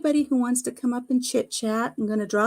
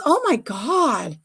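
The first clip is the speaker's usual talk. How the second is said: higher, drawn-out shock, imitated; 4 semitones higher, 4 dB louder, much slower than usual. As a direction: imitate an aghast shop assistant, slowly. Retells store lady's shocked reaction.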